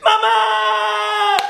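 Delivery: high, drawn-out cry for mom; hammed-up howl of an injured child; pained wail. Child hurt after falling off skateboard. (grito agudo de dolor llamando a mamá)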